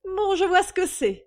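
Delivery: high, loud outburst; exasperated exclamation. A narrator's reading. Mistress jumping to conclusions about her visitor, exclaiming. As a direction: exclaim it impatiently. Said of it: "s'exclama Suzanne Damour".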